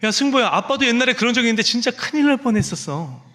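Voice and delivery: high, overdone dramatic warning; exaggerated alarm. Father overplaying alarm to his son about the toy, exaggerated. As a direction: ham it up to scare him a little. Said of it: "일부러 더 오버하면서 얘기했습니다".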